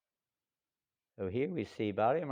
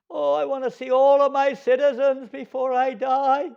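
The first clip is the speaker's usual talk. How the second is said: pleading dying king; 14 dB louder than usual. Acted. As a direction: beg to see them before the end.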